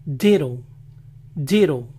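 "ditto" as "DI-row"; American "ditto" with flapped T; T as tapped R. Shown as a demonstration. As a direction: say "ditto" with a Spanish single R for TT. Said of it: "cambio de pronunciación de la T".

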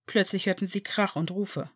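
ordinary delivery

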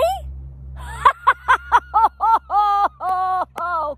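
long, loud, high mocking laugh. Real, not acted. Vlogger laughing at her own impression, loud and long. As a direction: burst out laughing at it.

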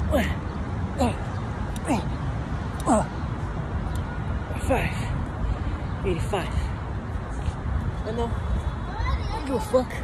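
breathless final reps; doing push ups. Real, not acted. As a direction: squeeze out each count, exhausted.

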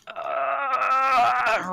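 high effortful grunt; straining grunt. Acted. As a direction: strain as you reach down. NPC strains reaching under bed.

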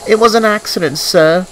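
small, high, apologetic voice; meek Thomas. A narrator's reading. Thomas excuses the accident to his boss, meek and high. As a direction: plead meekly in a high little voice. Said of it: "he replied meekly".

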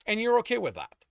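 loud, high incredulous question; disbelief. A narrator's reading. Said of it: "Sto asked in disbelief".

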